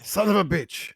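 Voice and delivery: high, loud curse at losing; frustrated outburst. Acted. Gambler cursing his lost bet at the bar, loud frustrated outburst. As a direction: spit it out like you just lost a gold. Loses the bet, slams head.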